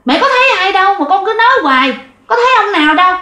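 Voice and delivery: exasperated mother scolding. Retold or imitated. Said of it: "dì lại la".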